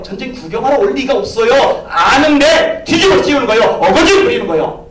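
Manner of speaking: loud, high preaching; preacher's outraged crescendo; rising indignation. Real, not acted. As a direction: hammer the injustice home.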